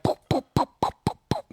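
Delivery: high popping knock sounds; imitated knocking. Showing friends how she banged the ceiling, high pops. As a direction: tap out the knocks with your mouth. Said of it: "And I went like".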